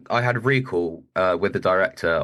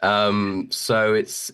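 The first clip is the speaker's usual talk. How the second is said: ordinary talk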